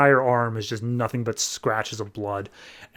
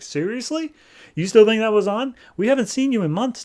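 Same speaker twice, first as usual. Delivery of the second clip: high-pitched mock disbelief; retold scornful astonishment; incredulous; 10 semitones higher, 3 dB louder than usual. Daughter's disbelief acted out.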